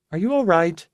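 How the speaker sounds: lightly voiced sick young woman, high; airy, distracted girlish voice; high fragile young woman. Rebus voiced by narrator.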